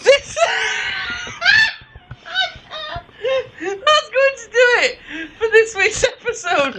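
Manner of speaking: high, breaking with laughter; laughing through words. Real, not acted. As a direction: try to sign off while cracking up. Host wraps up while cracking up.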